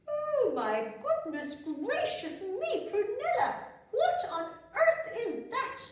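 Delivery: slow, high, loud astonished queen; drawn-out royal astonishment; shocked queen. Acted. Queen sees daughter's trunk, slow high astonishment. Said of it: "the queen ... said".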